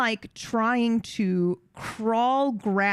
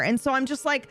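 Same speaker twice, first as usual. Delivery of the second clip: normal talking voice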